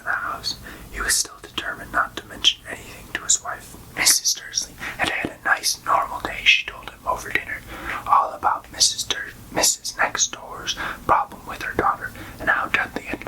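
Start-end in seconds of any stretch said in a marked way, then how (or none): none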